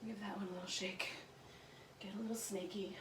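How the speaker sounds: soft whispered cue. Shown as a demonstration. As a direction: breathe the words out quietly. Instructor cue, repeated softly.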